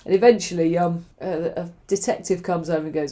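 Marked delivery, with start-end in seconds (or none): none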